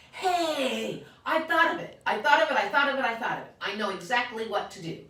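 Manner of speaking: high, loud eureka shouting; child yelling her discovery; excited shout. Acted. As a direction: burst out with the big idea. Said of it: "I shouted".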